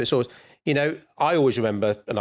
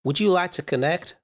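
high, put-on American salesy greeting; higher-pitched, hammy American voice; American accent; 8 semitones higher than usual. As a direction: slip into a mock American accent. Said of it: "Is that an American accent?".

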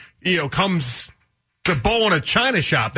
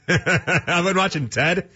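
slow, laughing through it; much slower than usual. Real, not acted. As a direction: let the laugh spill into the words. Host still laughing, slowed.

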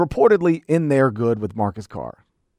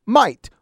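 "might" punched higher, as a single emphatic word; stress on MIGHT; 5 semitones higher, 3 dB louder than usual. Real, not acted. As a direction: hit the word "might" hard. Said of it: "emphasis on the word might".

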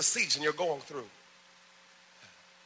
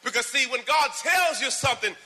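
preaching at full force; 9 semitones higher, 7 dB louder than usual. Acted. Preacher crying out to the congregation, high and loud. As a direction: lift the church with a rising call.

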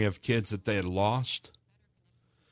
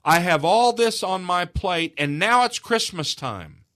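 upset mother; 6 semitones higher, 5 dB louder, slower than usual. Retold or imitated. Mother upset with persistent kids.

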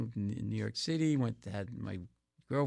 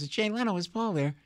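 higher-pitched celebrity impression on the phone; Jay Leno; 10 semitones higher than usual. Comic imitating Leno phoning him, high impression. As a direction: lift the voice into a light caller impression. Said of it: "it was Jay Leno".

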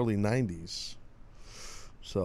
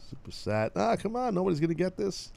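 ordinary talk, nothing marked

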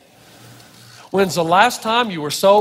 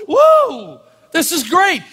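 higher, exuberant, with a high whoop; carefree party shout; joyful abandon; 5 semitones higher, slower than usual. Retold or imitated. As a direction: celebrate without a care. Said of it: "it's like David is saying".

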